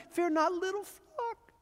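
a tender shepherd comforting his flock. Acted. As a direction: coax it gently, lifting high. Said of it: "he does it so tenderly".

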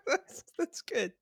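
high squealing laugh into the words. Real, not acted. Laughing at Brad's president comparison. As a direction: crack up then speak through it.

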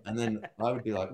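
normal talking voice

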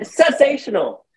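high, slow SENSATIONAL; drawn-out emphasis. Acted. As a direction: stretch out sensational with flair. Echoing the show's title.

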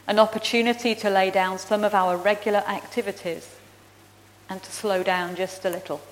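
earnest liturgical prayer voice. Acted. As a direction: lead the church in prayer, measured and reverent. Leads congregation in prayer.

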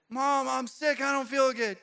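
complaining to mom; whiny kid. Retold or imitated. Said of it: "you had a parent".

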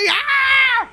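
high, sharp yell of rage; mock angry scream. Storyteller acts the drunk woman charging back. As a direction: let out a furious cry. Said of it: "elle s'en vient comme fâchée".

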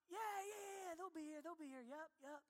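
high, fading nervous reassurance, near tears; a little boy covering his worry, softening; nervous kid. Retold or imitated. Boy insisting ride will come.

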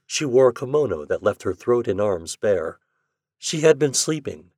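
ordinary delivery

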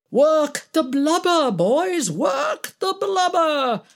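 high, drawn-out mock rallying cry; sing-out, high-pitched mocking imitation. A narrator's reading. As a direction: mimic her exhorting the crew, hammed up. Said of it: "mocked Alex".

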